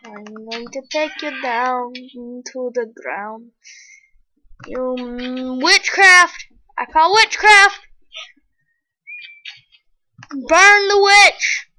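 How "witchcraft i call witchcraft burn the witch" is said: loud, higher accusing cry of witchcraft; shouted accusation. Pretend. Player calling out an enemy as a witch, loud accusing shout. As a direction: yell it out like a village mob.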